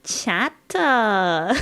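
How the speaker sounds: hammed-up Twitch streamer accent, "Chat-ah"; mock Twitch accent with an extra drawn-out "ah". Shown as a demonstration. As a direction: do a Twitch accent, stretching the tacked-on "ah". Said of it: "I mean, the, the Twitch accent?".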